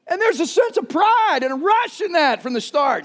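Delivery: usual speaking voice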